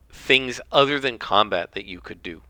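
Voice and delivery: ordinary delivery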